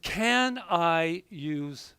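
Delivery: very slow, fading "Can I use your healing story on Sunday?"; slowly, reading the text aloud; slow text readout. Retold or imitated. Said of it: "I said".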